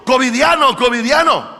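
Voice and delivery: loud, high repeated cry; hammed-up alarm call; mock warning shout. Parodies the lepers' shouted warning.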